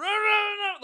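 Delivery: high, frantic mock outburst; losing it. Mother re-enacts yelling at her daughter, losing it. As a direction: retell snapping at a nagging child, pitch shooting up. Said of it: "lost my mind".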